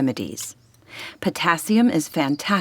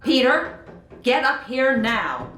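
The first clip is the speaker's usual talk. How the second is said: higher-pitched parental call; stern summons; 6 semitones higher than usual. Acted. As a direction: summon a child in trouble. Angry mother summons her son.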